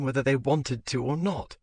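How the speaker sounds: usual speaking voice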